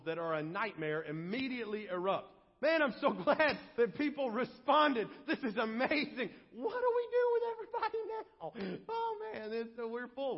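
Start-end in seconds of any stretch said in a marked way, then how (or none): 2.6-6.3 higher, louder, laughing excitement; hammed-up overjoyed amazement; acted delight. Acts church overjoyed at the crowd. 6.5-10.4 very high, squealed alarm; hammed-up flustered falsetto; mock panic. Acts church overwhelmed by sudden growth.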